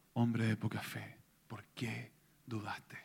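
a tender challenge, slowed and hushed; soft rebuke. Acted. Jesus gently rebukes Peter.

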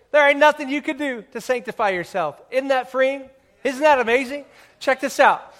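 high, loud fired-up preaching. Acted. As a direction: preach it with full force. Preaching at full force.

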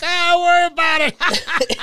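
high, loud, drawn-out brush-off; mock reassuring cop. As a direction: play an officer grandly waving it away. Voices officer waving off a ticket.

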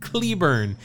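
ordinary talk

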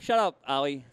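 usual speaking voice

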